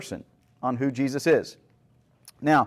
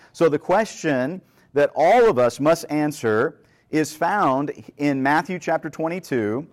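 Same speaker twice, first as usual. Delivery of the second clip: ordinary delivery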